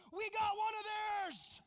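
high, loud, slow gloating yell; gleeful shout. Retold or imitated. Boy on the bench gloating at the other team, loud high shout. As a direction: crow it like a kid from the bench. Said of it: "jumped up off the bench and said".

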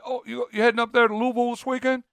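put-on high Southern accent, marbles in the mouth. Imitating locals outside Louisville, very Southern, mumbled accent. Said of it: "very Southern, talk like got marbles in their mouth".

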